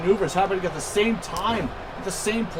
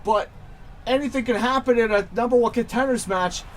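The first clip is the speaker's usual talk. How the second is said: wrestling commentator; 6 semitones higher than usual. Acted. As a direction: call the finish like a hyped announcer. Live wrestling play-by-play.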